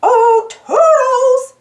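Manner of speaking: high, slow, loud sing-song call. Acted. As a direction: call the helper from afar. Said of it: "Mickey called".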